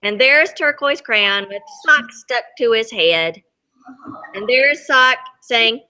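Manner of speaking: ordinary delivery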